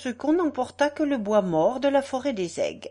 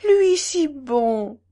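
higher, louder, slowly drawn-out protest; incredulous protest; 5 semitones higher, 6 dB louder, much slower than usual. A narrator's reading. Countess defending the general against talk of enemies, drawn-out incredulity. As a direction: stretch it out in tender disbelief.

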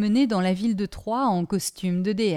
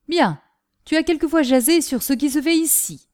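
lightly voiced commanding father, louder; a touch of paternal authority, pitched up; stern father; 6 semitones higher, 4 dB louder than usual. A narrator's reading. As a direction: give the father firm, raised orders.

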